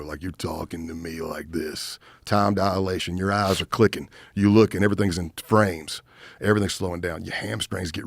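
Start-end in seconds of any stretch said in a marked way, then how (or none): 0.0-2.0 slow, low and soft, words drawn out; slowed-down dreamy delivery; slow talk. Shown as a demonstration. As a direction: talk like time is slowing down. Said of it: "It's slow".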